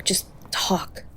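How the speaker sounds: soft, desperate whisper; pleading whisper. Acted. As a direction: beg them in a whisper to keep talking. Begging the partner to stay awake.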